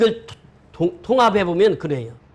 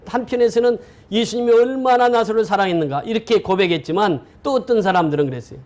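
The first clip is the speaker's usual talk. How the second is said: ordinary delivery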